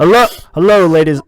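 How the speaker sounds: radio voice. Acted. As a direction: open like a radio presenter. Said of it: "I am doing my radio voice".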